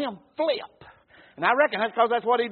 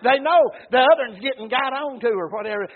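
normal talking voice

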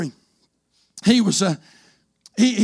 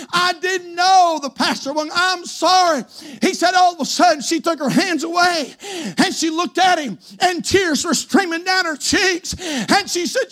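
high, driven pulpit preaching; full-force preaching; 6 semitones higher than usual. Real, not acted. Preacher warning the congregation of hell, full-force preaching. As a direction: preach it with long, leaning stresses.

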